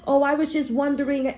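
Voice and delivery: high-pitched mocking imitation; cat voice. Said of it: "I don't care for your cat voice".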